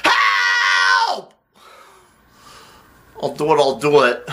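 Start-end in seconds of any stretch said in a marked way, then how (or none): 0.0-1.3 long, drawn-out yell; cry for help. Acted. Producer begging not to go to prison, howling cry for help. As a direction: howl it out at length.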